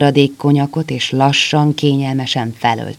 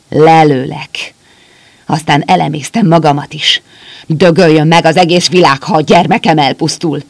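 desperate threat; 3 semitones higher, 7 dB louder than usual. A narrator's reading. Soldier threatens to shoot the doctor and himself, loud. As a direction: spit it out with nothing left to lose.

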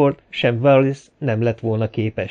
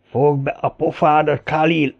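raised, hostile voice; snarled retort; 5 semitones higher than usual. A narrator's reading. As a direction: snarl back at him. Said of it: "morogta felhúzott innyel Volodya". (dühösen visszavicsorogva)

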